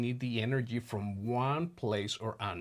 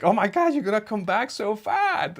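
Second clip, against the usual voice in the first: higher, louder mock-exasperated wife; imitating wife; 9 semitones higher, 8 dB louder than usual. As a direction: tease him like an amused, scolding wife. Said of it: "And she'd be like".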